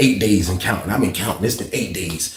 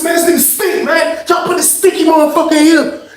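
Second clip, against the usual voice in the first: very high, strained complaint; angry protest; 5 dB louder than usual. Retold or imitated. Inmate complains to officer about cellmate, angry protest. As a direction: plead your case in a high, heated voice.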